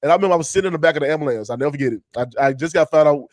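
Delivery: ordinary talk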